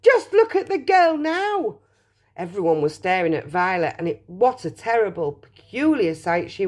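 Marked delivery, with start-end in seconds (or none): 0.0-1.8 high-pitched motherly alarm; distressed cry. A narrator's reading. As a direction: cry out in dismay. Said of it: "cried Missus Beauregard".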